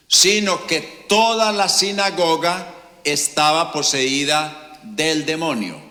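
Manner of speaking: normal talking voice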